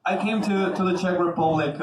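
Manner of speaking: ordinary talk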